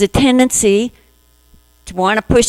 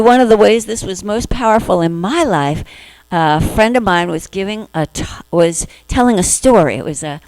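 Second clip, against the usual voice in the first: ordinary delivery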